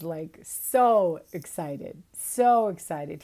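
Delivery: so excited, drawn out slow and loud. Real, not acted. Telling viewers, loudly drawn out excitement. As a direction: stretch the words out with delight. Excitement over Juan Diego's joy.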